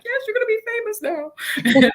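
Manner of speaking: playful teasing. Retold or imitated. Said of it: "And I'm like".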